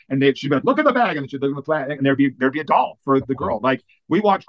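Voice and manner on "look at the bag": imitated insistence, very high pitch; high-pitched retold urging; insistent. Heidi urging the kids to search, insistent.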